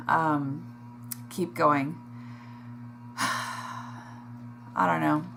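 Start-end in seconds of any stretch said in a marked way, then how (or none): 2.7-5.2 sighing. Real, not acted. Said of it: "I feel very emotional".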